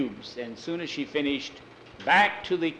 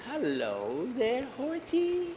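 slow coaxing hello, horsey floating up high; sweet greeting; 11 semitones higher, 3 dB quieter, slower than usual. Pretend. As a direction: coax the horse gently. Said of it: "if I went in and said".

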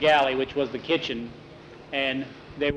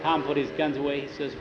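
ordinary delivery, nothing marked

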